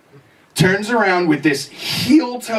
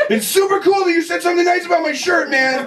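higher, shouted mock-angry praise; mock mad; 11 semitones higher than usual. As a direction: yell the compliment like you're furious. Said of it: "He's, like, getting mad now".

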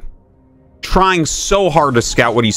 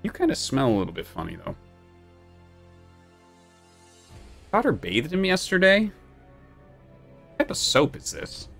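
talking to a puppy; 5 semitones lower, 8 dB quieter than usual. Real, not acted. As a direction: hush and coo over the dog. Cooing softly to puppy.

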